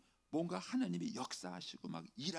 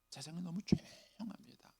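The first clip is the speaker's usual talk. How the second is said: whispered, hushed lament; 5 semitones higher than usual. Shown as a demonstration. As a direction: breathe the words in a whisper. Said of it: "whispered repeat". (속삭이듯 세상이 너무 조용합니다)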